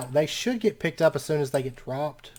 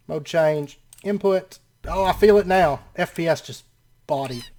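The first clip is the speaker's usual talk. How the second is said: ordinary talk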